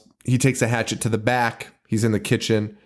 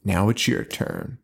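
menacing killer; 7 semitones lower, 5 dB quieter than usual. Retold or imitated. Quoting the killer Hawk's menacing lines.

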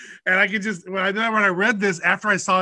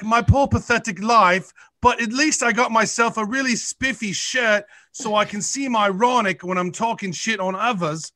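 mock-miserable British writer, slightly raised pitch; miserable British critic; 4 semitones higher than usual. Podcaster mocking his reviewer's photo, miserable British voice. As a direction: put on a self-pitying British sad sack. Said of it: "nothing amuses this guy".

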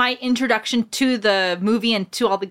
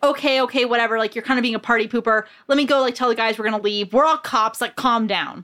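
ordinary delivery

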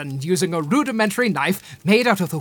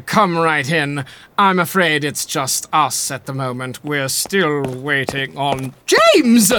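posh gentleman voice; 5 semitones lower than usual. Acted. Staying in character as host.

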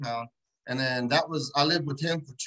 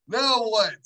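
usual speaking voice

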